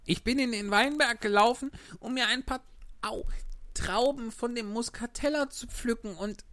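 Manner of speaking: put-on high puppet-boy voice; Pinocchio. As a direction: answer meekly, pitched far up. Pinocchio answers glowworm.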